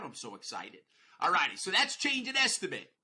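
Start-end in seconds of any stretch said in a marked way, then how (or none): 0.0-0.8 mock excitement. Real, not acted. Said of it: "I can barely get that out".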